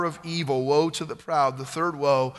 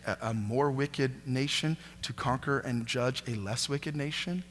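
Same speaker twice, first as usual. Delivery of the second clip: much softer, lower, puzzled plea to God; hushed questioning; 4 semitones lower, 8 dB quieter than usual. Acted. Habakkuk quietly pleading with God, soft and low. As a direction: ask it quietly, as if doubting in prayer.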